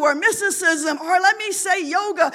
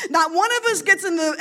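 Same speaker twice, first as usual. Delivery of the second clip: usual speaking voice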